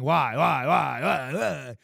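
loud, hammering whys; angry repeated why. Shown as a demonstration. Inside his own head, getting angry. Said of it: "I feel different".

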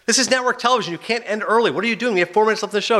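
high, pressed protest at the host; mock panic about dead air; a hint of a frantic producer; exasperated producer.

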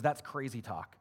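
ordinary delivery

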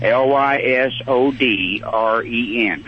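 slow letter by letter spelling. Shown as a demonstration. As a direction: spell each letter out slowly. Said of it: "I can spell it".

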